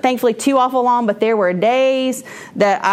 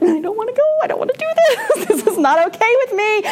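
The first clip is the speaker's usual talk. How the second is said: high, whining plea with a laugh at the end; whiny protest; 12 semitones higher than usual. Retold or imitated. Teacher dreading work on the drive in, high whine. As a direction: wail it like a kid who won't go. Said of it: "I would be like".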